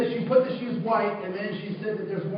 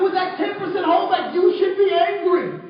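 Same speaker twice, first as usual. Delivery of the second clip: higher and louder, driving it home; emphatic declaration; 8 semitones higher, 7 dB louder than usual. Retold or imitated. Teacher telling the student his anger is justified, loudly. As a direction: press the point like a preacher.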